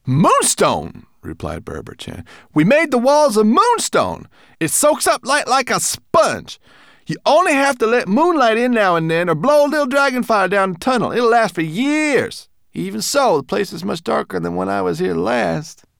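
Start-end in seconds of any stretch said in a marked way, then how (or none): 0.1-1.1 high, brisk little creature voice; a furry guide, pleased with himself; Berber Chan's voice. Acted. Small guide explaining the hidden door, high and brisk. 2.5-15.9 high, loud, proud creature voice; Berber Chan's voice. Acted. Guide showing off the moonstone walls, high and proud.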